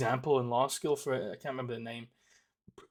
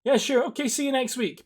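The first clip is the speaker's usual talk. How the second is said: eager yes-man; 10 semitones higher, 5 dB louder than usual. Pretend. Imagined voice actor agreeing.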